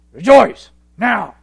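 loud, slow barked order; drill sergeant. Shown as a demonstration. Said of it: "like a drill sergeant".

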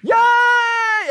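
high, loud whoop of joy; a bright shouted yay; mock cheer. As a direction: cheer like a happy churchgoer. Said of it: "Some may even do this".

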